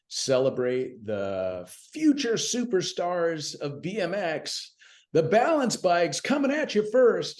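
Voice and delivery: lightly slipping into his announcing voice; announcer call. Retold or imitated. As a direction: call it like introducing riders at the gate. BMX announcer calling out riders.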